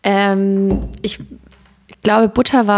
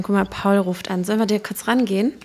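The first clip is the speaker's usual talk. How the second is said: usual speaking voice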